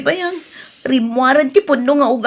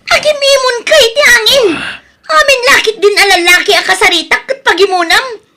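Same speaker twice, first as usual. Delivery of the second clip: loud, high, anguished outcry; anguished cry; 9 semitones higher, 6 dB louder than usual. Acted. Wife wailing at her jealous husband while he hurts her. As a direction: wail the accusation through pain. Wife cries out under abuse.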